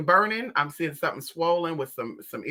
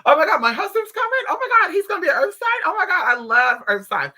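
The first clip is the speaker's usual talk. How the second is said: high, loud giddy gushing; excited client; 11 semitones higher, 6 dB louder than usual. Retold or imitated. Client hearing of a coming husband, high giddy gush. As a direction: gush like she just heard great news. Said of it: "And they were like".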